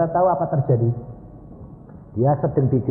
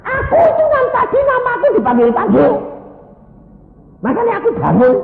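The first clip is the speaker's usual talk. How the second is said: acted high, loud outburst; indignant shouting; 11 dB louder than usual. Husband protesting to nurse that he was called, mock indignant shout. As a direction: yell it in comic exasperation.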